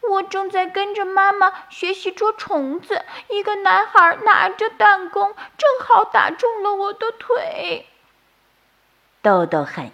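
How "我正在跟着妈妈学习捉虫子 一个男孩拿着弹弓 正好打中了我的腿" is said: high, tearful little bird voice; a small hurt chick telling its story through tears; crying. Acted. Injured chick telling Doudou what happened, high and tearful. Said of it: "小鸟哭着说".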